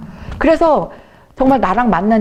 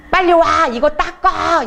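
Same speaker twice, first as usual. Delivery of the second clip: mock bossy order; 6 semitones higher, slower than usual. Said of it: "이러는 거예요".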